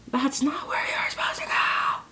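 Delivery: unvoiced scolding whisper. Real, not acted. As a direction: hiss it at the wayward liner. Scolding the liner.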